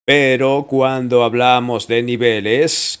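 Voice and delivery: ordinary talk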